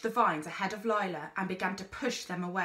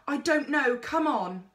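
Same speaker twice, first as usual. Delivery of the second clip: panicked; 6 semitones higher, 5 dB louder than usual. Acted. Carrying brother in panic.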